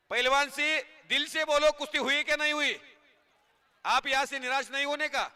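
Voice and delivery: rousing announcer patter over the crowd; live commentary. Acted. Commentator hyping the crowd at the kusti ground, announcer patter.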